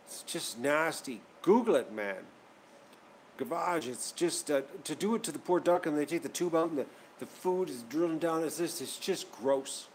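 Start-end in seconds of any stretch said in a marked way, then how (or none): none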